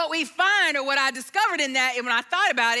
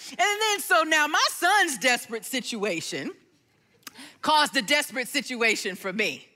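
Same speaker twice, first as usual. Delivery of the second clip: normal talking voice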